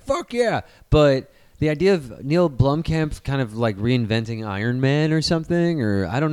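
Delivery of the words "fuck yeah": excited. Retold or imitated. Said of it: "I'm like".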